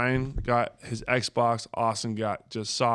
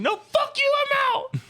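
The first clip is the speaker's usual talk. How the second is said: screaming little brother, very high; mock tantrum screech, way up high; kid's tantrum; 3 dB louder, slower than usual. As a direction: shriek it like a furious kid. Brother's tantrum acted out in story.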